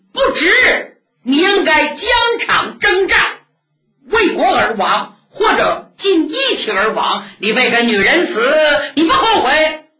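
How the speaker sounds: put-on high, loud, incredulous old nun voice; old nun scolding. Old nun scoffing at a man dying for love, high and loud.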